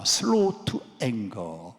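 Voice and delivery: drawn-out English phrase, each word stretched; very slow. Shown as a demonstration. Pastor to congregation, slowly stretching the verse. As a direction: let slow to anger crawl out.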